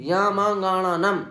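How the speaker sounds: या मां गाणा नम said slowly with nasal sounds; nasal recitation. Shown as a demonstration. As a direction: draw out या मां गाणा नम through the nose, without pulling a face. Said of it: "ये अनुनासिक है, इसलिए इनका नासिका से उच्चारण". (अनुनासिक उच्चारण)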